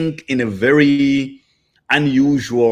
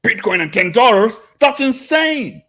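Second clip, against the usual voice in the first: high, slow mock astonishment; mocking old skeptics; 8 semitones higher, slower than usual. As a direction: play a stunned doubter, drawn out and high. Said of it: "They're like".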